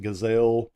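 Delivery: "Gazelle" said like the animal, stress on the second syllable; common mispronunciation. Shown as a demonstration. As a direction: say "Gazelle" as guh-ZELL, as some people do. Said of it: "some people pronounce it".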